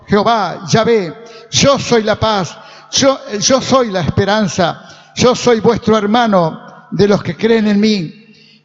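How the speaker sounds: loud, rhythmic proclamation; forceful preaching. Real, not acted. Preacher proclaims Jesus's "I am" sayings to congregation, forceful preaching. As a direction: hammer out each declaration at full voice.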